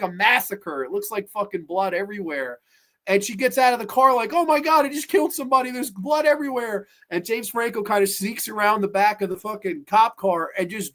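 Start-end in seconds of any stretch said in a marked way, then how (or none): 4.3-6.9 high-pitched imitation of alarm; lightly acted shocked policewoman; mock horror. Cop horrified at supposed killing.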